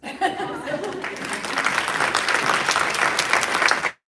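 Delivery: loud high embarrassed laughter. Real, not acted. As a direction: burst out laughing, flustered. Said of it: "I'm embarrassed".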